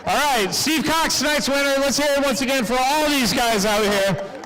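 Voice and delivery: projected, rounded announcer sign-off; announcer rallying the crowd. Real, not acted. Announcer sending off tonight's winner to applause, big projected delivery. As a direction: call for applause like a track announcer.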